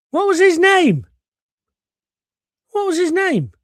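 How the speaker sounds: high, loud cry of frustration; exasperated shout. Real, not acted. Host who forgot the actor's name, exasperated shouting. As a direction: yell it, straining to remember.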